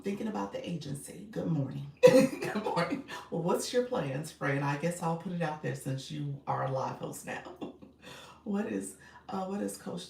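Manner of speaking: usual speaking voice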